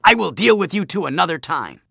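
loud, high, angry bluster; parting threat. Acted. Bully leading friends away, loud angry threat. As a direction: throw a threat back as you retreat.

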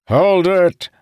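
high, loud shout. Acted. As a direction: shout the order to halt. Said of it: "Moradin shouted".

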